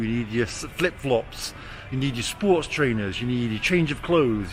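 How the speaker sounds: usual speaking voice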